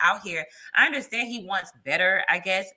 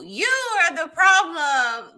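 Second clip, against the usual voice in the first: higher, slow mock accusation of the panelist; imitating his accusation; 8 semitones higher, 5 dB louder, slower than usual. Guest mimicking the panelist who blamed her, higher and slow. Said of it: "He was like".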